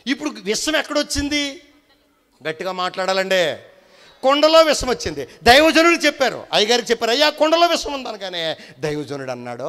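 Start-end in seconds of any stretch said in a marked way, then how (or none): 4.2-8.1 loud, high sermon proclamation, much louder; preaching at full force. Acted. Preacher retelling the poisoned pot to the church, booming. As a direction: drive the story home at full volume.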